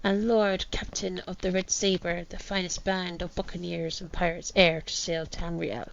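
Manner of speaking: attempted pirate accent, reading aloud. Captain writes his last words, attempted pirate accent. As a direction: read it like an old pirate captain. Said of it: "my attempt at a pirate accent".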